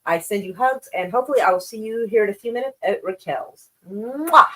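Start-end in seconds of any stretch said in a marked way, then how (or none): 3.9-4.5 loud smacking air kiss, pitched up; playful mwah kiss to viewers; blown kiss. Real, not acted. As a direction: send the chat a big kiss.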